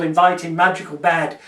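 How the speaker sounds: ordinary talk, nothing marked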